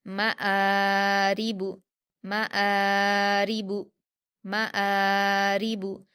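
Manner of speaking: मआरिबु slowly, quick म, long held आ; short म, then stretch आ in मआरिबु; stretched hamza. Shown as a demonstration. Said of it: "हमज़ा को खींचेंगे, मीम को जल्दी पढ़ेंगे".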